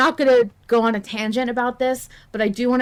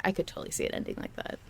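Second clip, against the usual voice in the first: hushed aside; 3 semitones lower, 12 dB quieter, much faster than usual. Real, not acted. Hushed aside after voicing John.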